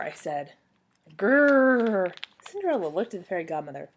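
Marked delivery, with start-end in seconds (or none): 1.2-2.1 louder, higher, drawn-out "grrr"; scary growl. A narrator's reading. Child clown growling at Cinderella a second time, scary growl. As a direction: growl it out to frighten them. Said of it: "I made a scary clown face".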